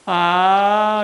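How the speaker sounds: high mock child's wail; child starting to cry. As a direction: imitate a small boy bursting into tears. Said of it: "이렇게 이제 울게 되는 거지".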